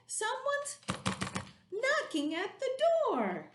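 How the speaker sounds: squeaky little monster; a small excited monster warning of a knock; high worried monster voice. Acted. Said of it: "The orange one said".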